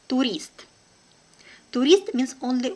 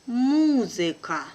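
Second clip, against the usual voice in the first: slow and careful, drawn out for learners; teacher's careful slow reading; 3 semitones higher, 3 dB louder, slower than usual. Shown as a demonstration. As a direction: spell it out slowly for the class. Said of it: "one first time will be slow".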